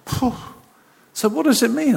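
normal talking voice

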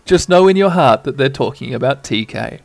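ordinary delivery

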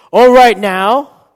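slow, high, very loud rally; hyped-up battle cry. Acted. Preacher voices what David doesn't say, triumphant shout. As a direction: belt it out, drawn out and high. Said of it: "he doesn't say".

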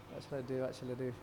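a quiet worried mutter off-mic; muttered to himself. Real, not acted. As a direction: mumble it under your breath, unsure how to fit this in. Muttering to himself about time.

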